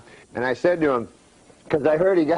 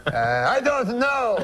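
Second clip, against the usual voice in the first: slow, high French Canadian bafflement; comic French-accented uncle giving up; 8 semitones higher, slower than usual. Acted. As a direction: draw it out as the stumped Frenchman. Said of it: "That's how he talks".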